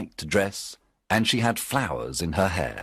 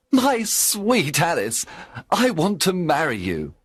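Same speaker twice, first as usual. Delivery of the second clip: tender Alan; 9 semitones higher than usual. Acted. Alan's tender proposal.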